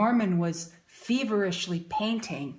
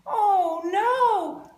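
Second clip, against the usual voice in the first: mock dismay; 10 semitones higher, 6 dB louder than usual. Reacting to picture for children.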